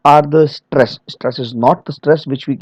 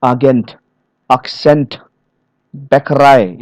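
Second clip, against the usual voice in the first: "Agent, accent, Bäckerei" leaning on GENT, CENT, REI; final-syllable stress. Shown as a demonstration. Trainer showing French-origin loan words, final-syllable stress. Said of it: "they stress the final syllable".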